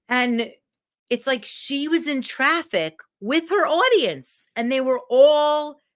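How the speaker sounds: usual speaking voice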